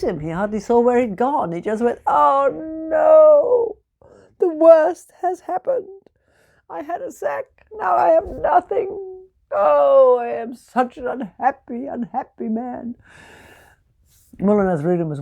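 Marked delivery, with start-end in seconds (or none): 2.1-13.0 much higher, louder sobbing lament; hammed-up crying tinker; crying wail. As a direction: wail it through mock tears. Sack stolen, Tommy cries.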